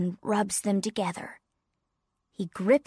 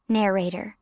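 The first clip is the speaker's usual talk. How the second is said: usual speaking voice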